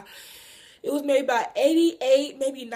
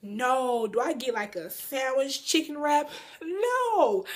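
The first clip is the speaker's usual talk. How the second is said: normal talking voice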